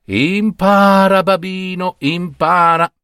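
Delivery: high, slow, emphatic teaching; mother's lesson. Acted. Mother drills her son, high and slow. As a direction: drum the lesson in, stretched out.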